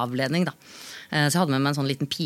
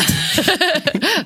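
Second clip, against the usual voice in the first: loud high laugh; sudden laughing burst; burst of laughter; 11 semitones higher, 5 dB louder than usual. Real, not acted. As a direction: laugh out at yourself. Laughs at own story.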